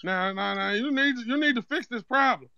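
put-on Zeke accent; Zeke-style street accent. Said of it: "that accent funny as shit".

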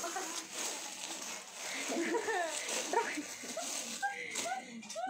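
long helpless giggling fit; breathy drawn-out laugh; real laughter. Real, not acted. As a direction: laugh on and on, wordlessly. Host laughs during live selling.

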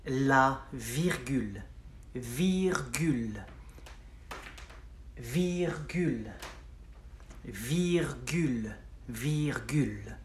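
"virgule" drawn out slowly, both syllables long; slow repeat-after-me. Shown as a demonstration. Teacher giving learners the word for comma to repeat, slow and stretched. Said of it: "Repeat after me for the comma".

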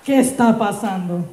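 ordinary talk

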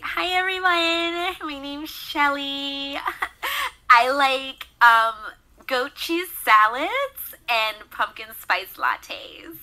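put-on high, drawn-out Valley girl voice. Shown as a demonstration. Voice actor showing off favorite character, high slow Valley girl. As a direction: stretch the words out like a ditzy Valley girl. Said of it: "love doing the Valley High girl".

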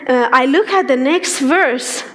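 ordinary delivery

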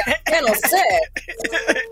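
mock evening news intro; newscaster voice. Shown as a demonstration. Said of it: "newscaster voice".

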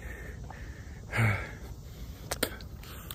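long breathy exhale; out-of-breath sigh. Real, not acted. Vlogger winded after working out, long sigh. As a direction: let out a long, tired breath. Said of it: "I'm out of bread".

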